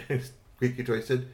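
ordinary delivery

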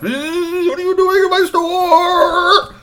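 high, loud, slow furious shriek; an old man stretching out his outrage; outraged shopkeeper. Acted. Storyteller voicing Mister Tuddy shrieking at the boys in his store.

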